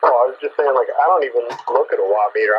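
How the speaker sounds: ordinary talk, nothing marked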